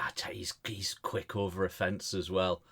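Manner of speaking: whispered aside. Real, not acted. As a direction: hush it so he can't hear. Confiding about the neighbour nearby.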